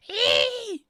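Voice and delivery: shrill, loud high squeal; high frog squeak. Shown as a demonstration. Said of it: "I can't do a frog noise".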